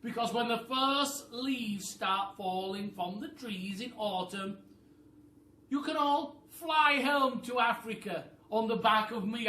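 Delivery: high, loud bossy bird voice; a high, loud, clever-bird voice; roly-poly bird voice. Acted. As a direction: squawk it like a know-it-all bird. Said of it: "said the roly-poly bird".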